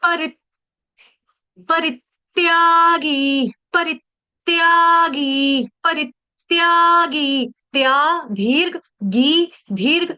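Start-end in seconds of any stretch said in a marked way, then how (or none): 1.7-7.5 परित्यागी slow, short री with a stress on it. Shown as a demonstration. Teacher drilling the stress on री for students, slowly. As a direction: hit the री in परित्यागी. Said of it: "री के ऊपर आघात".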